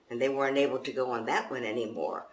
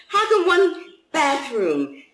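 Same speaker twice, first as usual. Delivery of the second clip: much higher, louder, slow question; wondering refugees; 12 semitones higher, 8 dB louder than usual. Acted. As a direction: lift it high and loud in disbelief. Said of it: "they wondered".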